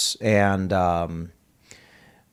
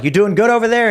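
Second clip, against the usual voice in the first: higher, louder question tossed across the table; calling across; 10 semitones higher, 4 dB louder than usual. Retold or imitated. Tim asks the guy across the pit how he's doing, loud friendly call. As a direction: shout it over the casino noise.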